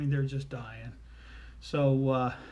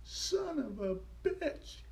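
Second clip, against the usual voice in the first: high, slow, softened little voice; toddler copying correction; 12 semitones higher, 6 dB quieter, much slower than usual. Retold or imitated. Little girl repeats it for the girls, slow high precise cursing. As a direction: draw it out in a tiny high voice. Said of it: "And Becky's like".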